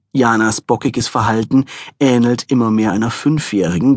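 plain reading voice

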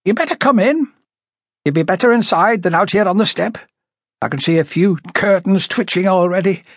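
high, chattering character voice; high-voiced gossipy householder. A narrator's reading. Woman inviting police inside from nosy neighbours, high-voiced. Story character, Miss Graham.

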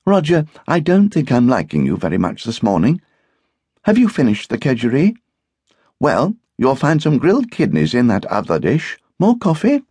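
high-pitched wifely voice; hostess, mildly cross. A narrator's reading. Hostess serves Roger breakfast, mildly cross. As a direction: scold lightly, then play hostess. Hostess cool to her guest.

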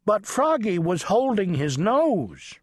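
usual speaking voice

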